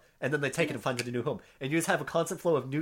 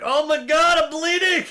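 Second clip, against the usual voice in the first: high, loud mock-panicked scream; imitated freak-out; 13 semitones higher, 12 dB louder, slower than usual. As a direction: yell it like the injured kid panicking. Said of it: "he just freaks out and he's like".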